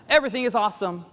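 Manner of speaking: sing-songy overexcited American; mock enthusiasm. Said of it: "It's like the Lego movie".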